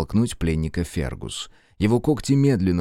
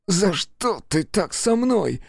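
much higher, hurt whine; offended; 11 semitones higher than usual. Acted. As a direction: sound wounded and betrayed. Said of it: "обиженно поджал губы Джейкоб".

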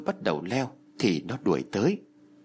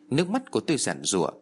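plain reading voice

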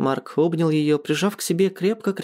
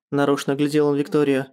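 plain reading voice

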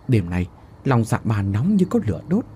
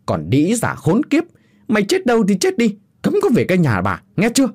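put-on high, loud screaming abuse at the old woman; furious roar; 10 semitones higher, 3 dB louder than usual. A narrator's reading. Cruel daughter-in-law throwing her mother-in-law out, furious shrieking roar. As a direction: bellow her out the gate, shrill and loud. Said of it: "Mụ đứng chống nạnh chỉ tay mà gầm lên".

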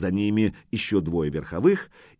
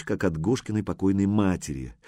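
plain reading voice

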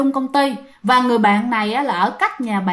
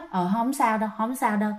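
soft, dismissive reassurance; retold uneasy "never mind"; quiet brush-off; 2 semitones lower, 8 dB quieter than usual. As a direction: drop the voice and wave it away.

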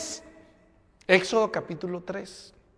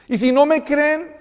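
Moses objecting; 10 semitones higher, 8 dB louder than usual. Acted.

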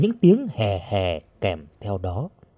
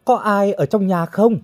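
higher-pitched call into an empty house; gọi với vào nhà; 8 semitones higher than usual. A narrator's reading. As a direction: call out to whoever might be inside. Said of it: "Hồng lại gọi". (cất giọng cao gọi hỏi)

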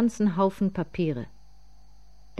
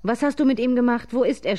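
raised, higher, louder voice; loud, high-pitched narrated shout; screamed demand; 7 semitones higher, 4 dB louder than usual. A narrator's reading. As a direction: cry out the accusation. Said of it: "schrie die Großmutter".

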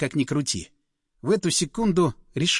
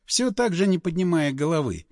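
ordinary delivery, nothing marked

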